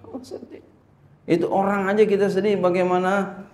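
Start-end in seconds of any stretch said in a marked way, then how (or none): 0.1-0.6 sad whisper; barely voiced, hushed dejection; acted sadness. Preacher answering whether it hurts, in a sad whisper.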